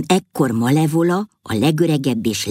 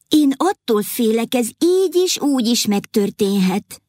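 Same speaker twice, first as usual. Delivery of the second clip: queen's reply; 7 semitones higher than usual. A narrator's reading. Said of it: "válaszolta a felesége".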